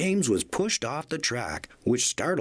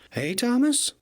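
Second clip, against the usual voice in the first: cheery call; 12 semitones higher than usual. A narrator's reading. Salty calling cheerfully.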